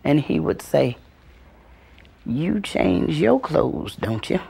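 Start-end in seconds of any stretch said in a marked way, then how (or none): 2.2-4.4 slow, soft, pointed; knowing question. Retold or imitated. Granddaddy teaching the child slowly, soft and knowing. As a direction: lean in and let it land.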